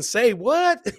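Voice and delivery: normal talking voice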